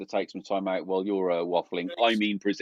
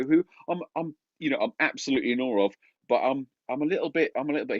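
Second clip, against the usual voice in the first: usual speaking voice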